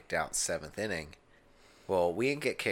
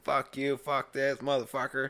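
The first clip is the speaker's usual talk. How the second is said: higher, mock drunken cursing; cursing out security; 7 semitones higher than usual. Drunk gambler swearing at casino security, mock angry. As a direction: spit curses at the guards. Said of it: "I'm still saying".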